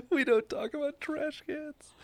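helpless high-pitched laughter breaking up the words; laughing. Real, not acted. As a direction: squeal it out slowly through laughter. Cracking up at co-host's remark.

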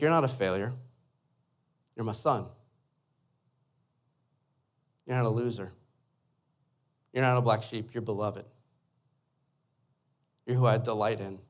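low, soft fatherly affirmation; quiet, unhurried comfort from a dad; tender reassurance. Retold or imitated. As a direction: speak gently, letting each line land. Father tenderly lifts son's shame.

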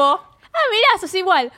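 a hammed-up gasp of discovery at a double; mock surprise. Movie girl meeting her double, mock surprised.

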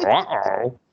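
lightly imitated cartoon dog; mock a bad talking-dog voice; a hint of goofy cartoon hound; Scooby-Doo. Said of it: "trying to do a Scooby impersonation".

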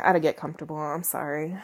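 ordinary talk

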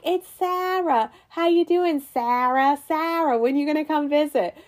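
high-pitched mock Boston greeting; Boston accent. Imitating friend's in-laws greeting her on FaceTime, Boston accent. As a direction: greet Sarah like gushing Boston parents. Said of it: "I love Boston accents".

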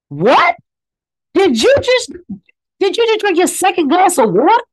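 playing his strict parent catching him out; mock scolding parent. Recalling how his parent punished him, mock scolding.

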